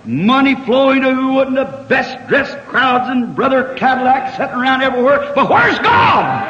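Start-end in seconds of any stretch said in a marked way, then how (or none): 5.3-6.4 high, drawn-out preacher's shout; impassioned cry. Real, not acted. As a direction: cry it out slowly to the whole congregation. Preacher cries out to congregation.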